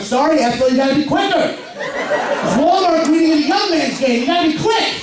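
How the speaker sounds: high teasing jab at a coworker; mock taunting. Comic taunting an old coworker, lightly mocking high voice. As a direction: rub it in, playful and smug. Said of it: "Then I give them shit".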